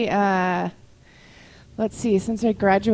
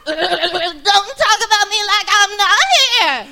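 very high, loud, slow protest; a daughter snapping back at her fussing mother; Julia Roberts as Shelby; 12 semitones higher, 7 dB louder than usual. Pretend. Hammed-up Julia Roberts as Shelby protesting. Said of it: "the part of Sally Field and Julia Roberts".

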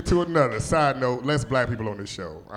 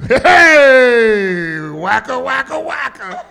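comic catchphrase; 11 semitones higher, 12 dB louder, much slower than usual. Pretend. Comedic catchphrase bit.

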